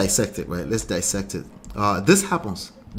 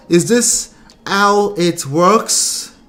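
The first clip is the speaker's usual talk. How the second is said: slow, high, louder incredulous question; 6 semitones higher, 6 dB louder, much slower than usual. Real, not acted. Host asking viewers in disbelief, slow and high. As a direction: drag it out in disbelief. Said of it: "rhetorical mock question".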